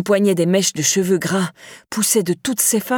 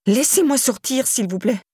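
higher, begging; frightened plea; 5 semitones higher than usual. A narrator's reading. Laura pleading with Myriam at the door, frightened. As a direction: beg to be let out.

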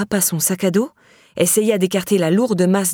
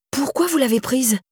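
put-on higher, urgent insisting young woman; insistent question; 6 semitones higher than usual. A narrator's reading. Laura pressing Myriam about the key, insistent. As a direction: press her for an answer. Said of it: "insista-t-elle, les yeux écarquillés".

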